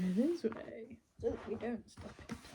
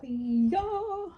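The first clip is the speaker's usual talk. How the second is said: high two-part hee-yaw, rising sharply; playful "hee-yaw" cry; 8 semitones higher, 4 dB louder than usual. Pretend. Vlogger wrapping presents, playful high hee-yaw. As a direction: let out a playful high yell.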